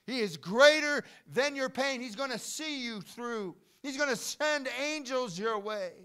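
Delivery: loud, high, impassioned proclamation; full-force preaching. Real, not acted. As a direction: cry it out over the congregation.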